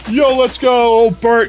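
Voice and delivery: excited cheer. Real, not acted. Cheering a new regular.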